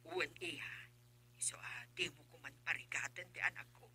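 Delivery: soft unvoiced whisper, pleading; whispered plea. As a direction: whisper it so nobody overhears. Mother secretly pleads with girlfriend.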